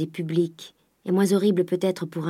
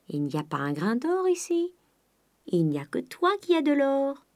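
coaxing miser; 8 semitones higher than usual. A narrator's reading.

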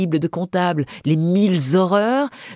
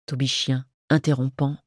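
usual speaking voice

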